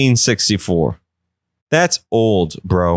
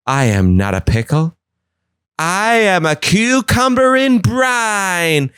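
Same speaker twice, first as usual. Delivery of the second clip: high, slow grand declaration; proud pickle; 8 semitones higher, slower than usual. Retold or imitated. Pickle proudly declaring to the gang, high and slow. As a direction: announce it with dignity. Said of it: "He's like".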